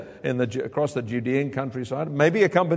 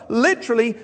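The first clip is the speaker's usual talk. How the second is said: ordinary talk, nothing marked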